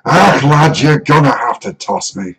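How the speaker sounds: loud, high gruff dwarf brogue; Scottish dwarf voice. Retold or imitated. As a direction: bellow it like Gimli. Quotes the dwarf Gimli.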